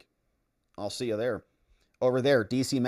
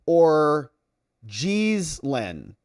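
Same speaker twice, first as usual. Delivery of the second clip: ordinary talk, nothing marked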